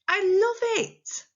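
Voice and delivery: high, slow, gushing exclamation; excited. Shown as a demonstration. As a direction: stretch it out with loud delight. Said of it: "they're surprised or they're excited".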